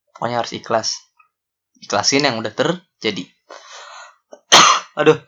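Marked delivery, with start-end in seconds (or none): 4.3-5.1 real cough, then a pained aduh; coughing fit. Real, not acted. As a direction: hack out a cough mid-talk. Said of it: "Ih, gua batuk mulu".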